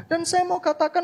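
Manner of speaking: usual speaking voice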